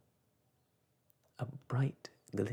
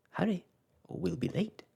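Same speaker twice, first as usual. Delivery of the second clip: high, louder, urgent sprite; urgent star voice; 7 semitones higher, 5 dB louder than usual. Acted. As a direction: rush them along brightly. Star urges haste.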